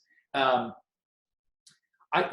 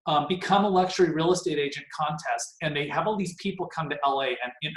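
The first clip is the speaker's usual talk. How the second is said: usual speaking voice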